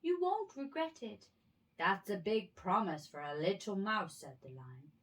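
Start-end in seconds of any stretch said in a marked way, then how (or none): 0.0-1.3 high, loud squeaky plea; pleading mouse. A narrator's reading. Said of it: "pleaded the mouse". 1.8-4.2 louder lion voice; a big lion, amused. A narrator's reading.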